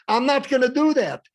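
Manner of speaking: high, loud defiant refusal; rebellious child. Acted. Rebellious child refusing a request, loud defiance. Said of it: "With rebellious child".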